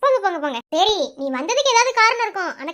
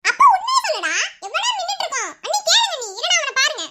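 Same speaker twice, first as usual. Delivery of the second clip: high-pitched insistent demand; impatient demand; 7 semitones higher than usual. Acted. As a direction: press for the truth, voice pitched way up. Sister demands brother confess. (பொறுமையிழந்த அதட்டல்)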